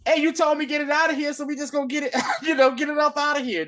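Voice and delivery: high, fast mock voice of a nervous man; nervous excuse. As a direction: squeak out a hurried excuse, high and quick.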